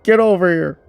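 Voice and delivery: loud, high retold shout; urgent re-enacted command; calling out. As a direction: yell it as if to someone in the other boat. Retells calling friend over from boat.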